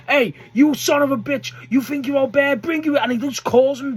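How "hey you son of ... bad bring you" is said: high, louder mock challenge; imitated angry call-out. Taz calls out Mike Awesome on the mic, imitated angry challenge. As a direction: act out a wrestler calling out his rival. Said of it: "fucking screaming at Taz. And Taz goes".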